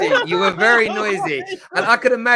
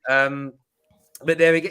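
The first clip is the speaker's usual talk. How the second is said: normal talking voice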